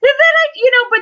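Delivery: mock crying. Crying after heartbroken remark.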